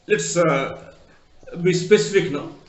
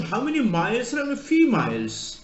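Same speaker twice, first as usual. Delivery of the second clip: higher Australian voice, "miles" like "males"; Australian accent; 6 semitones higher than usual. Retold or imitated. Australian agent asking an Indian customer, put-on Australian accent. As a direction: put on the agent's Aussie vowels. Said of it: "Australian speaks".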